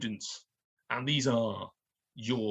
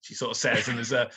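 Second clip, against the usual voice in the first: normal talking voice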